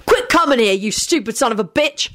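high-pitched angry order; high, irritated outburst; angry snapping. A narrator's reading. Rude man berates an intruder.

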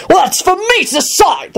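high, pompous retort; ferocious declaiming. A narrator's reading. Guard defies Lupin over security, ferocious. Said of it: "the fellow declaimed ferociously".

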